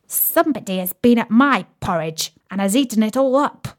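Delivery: ordinary delivery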